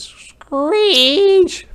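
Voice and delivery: loud, piercing stretched-out screech on the word; high, loud owl cry. Acted. Imitating an owl's screech.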